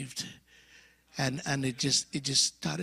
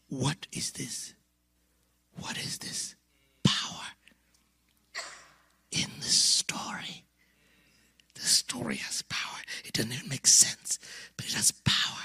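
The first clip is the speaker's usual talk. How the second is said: awed whisper; 5 semitones lower, 3 dB quieter than usual. Retold or imitated. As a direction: breathe it in wonder. Awed whisper at God's power.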